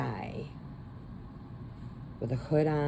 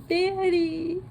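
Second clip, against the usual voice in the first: anguished cry; 4 dB louder than usual. Retold or imitated. Girl chooses her dad.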